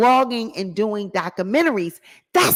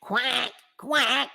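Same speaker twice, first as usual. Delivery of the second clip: softer duck imitation; duck quack; 2 semitones higher, 3 dB quieter than usual. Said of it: "quacking like a duck".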